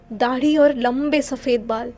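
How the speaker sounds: ordinary delivery, nothing marked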